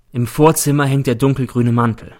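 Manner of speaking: ordinary delivery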